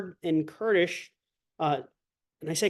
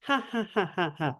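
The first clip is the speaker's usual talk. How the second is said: Peach laughing; 5 dB quieter than usual. Shown as a demonstration. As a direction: lift the laugh up like a princess. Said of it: "as opposed to Peach laughing".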